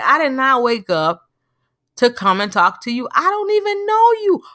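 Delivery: hammed-up indignant disbelief; mock exasperation. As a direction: pitch leaping up in mock protest. Acted exasperated thought at stranger.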